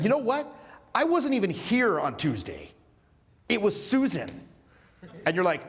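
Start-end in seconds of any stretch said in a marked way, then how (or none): 0.0-4.3 high-pitched, play-acted protest of innocence; indignant denial. Accused coworker blaming Susan for the sandwich, indignant denial.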